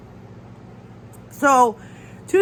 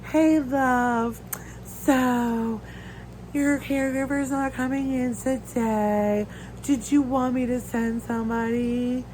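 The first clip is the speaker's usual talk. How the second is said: higher, slower sing-song imitation of the coordinator; mock her sweet phone voice, a bit higher; sugary coordinator impression; 4 semitones higher, slower than usual. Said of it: "which drives me crazy".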